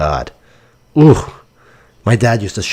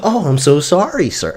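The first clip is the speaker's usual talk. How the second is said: higher-pitched, put-on customer apology; fake apologetic voice; 4 semitones higher than usual. Dad to a customer yelling about an order, mock-apologetic voice. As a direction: grovel in a fake customer-service voice. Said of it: "my dad make this fucking voice".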